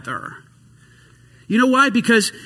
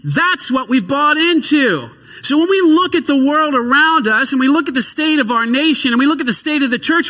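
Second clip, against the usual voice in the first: preaching at full force; 8 semitones higher, 3 dB louder than usual. Real, not acted. Preacher rouses the congregation, high and loud. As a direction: drive the point home loudly. Preaching climax after the shout.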